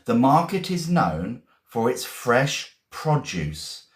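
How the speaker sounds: slow, careful teacher reading; slow model sentence. Shown as a demonstration. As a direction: spell it out slowly for the class. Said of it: "teacher models the sentence".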